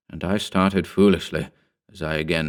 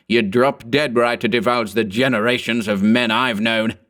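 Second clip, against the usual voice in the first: put-on archaic old English accent; 3 semitones higher than usual. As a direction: sneer at the lout in old English diction. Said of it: "he cried in a voice and accent strange to me".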